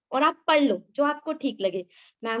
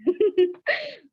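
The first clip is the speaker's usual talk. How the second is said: a big embarrassed laugh, much higher; loud high laugh; 8 semitones higher than usual. Real, not acted. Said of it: "just stop, एक सांस तो ले ले". (ज़ोर की ऊँची हँसी)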